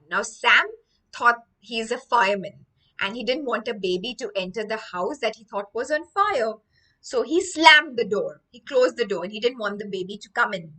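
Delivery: ordinary talk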